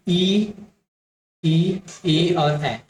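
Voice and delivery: ई, ए and ऐ stretched very slowly with spread lips; slow vowel demo. Shown as a demonstration. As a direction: draw each vowel out for the class to copy. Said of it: "यहाँ से आपके होठ कभी भी गोलाकार नहीं होंगे". (फैले होठों से धीरे धीरे ई, ए, ऐ)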